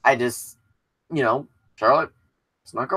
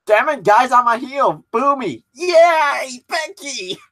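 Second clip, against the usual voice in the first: mock heel plea; 7 semitones higher, slower than usual. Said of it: "was like".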